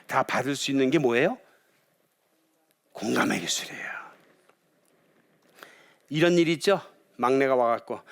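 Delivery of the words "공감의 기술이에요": hushed whispered reveal. Real, not acted. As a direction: whisper it like a secret. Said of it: "whispered key point".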